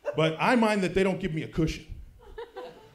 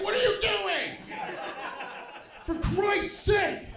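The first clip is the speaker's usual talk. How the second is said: exasperated dad; 13 semitones higher, slower than usual. Retold or imitated. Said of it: "I'm like".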